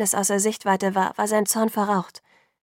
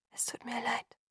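barely audible whispered apology; 3 semitones higher, 13 dB quieter than usual. A narrator's reading. Bella apologises as she leaves Jacob, whispering. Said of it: "flüsterte ich".

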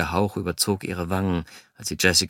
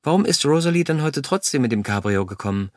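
pressing question, pitched up; probing; 6 semitones higher, 3 dB louder than usual. A narrator's reading. Bella digging for answers from the narrator, probing. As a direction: press for an answer, starting louder and higher. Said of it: "bohrte sie nach".

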